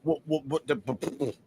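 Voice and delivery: stammering in disbelief; sputtering, lost for words; stunned stammer. Real, not acted. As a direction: trip over the words in shock.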